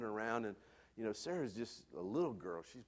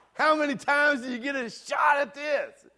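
much higher and louder, a thrilled shout; excited shout; 16 dB louder than usual. Retold or imitated. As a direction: seize the moment, shout it out with glee. Sudden idea, acting it out.

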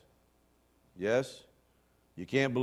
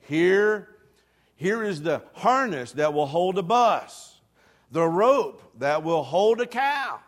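proclaiming assent; 5 semitones higher, 8 dB louder than usual. Shown as a demonstration. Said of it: "you've got to say".

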